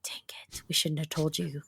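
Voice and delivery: hushed, sheepish whisper of the found-out ghosts; whispered grumble of ghosts who gave themselves away; caught-out whisper. Retold or imitated. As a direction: whisper it like you just got caught. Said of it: "And they're like".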